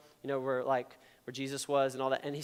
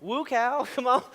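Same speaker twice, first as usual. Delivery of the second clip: a lighter grandmother cow call; 5 semitones higher than usual. Retold or imitated. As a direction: gently call the cows again. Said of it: "like that".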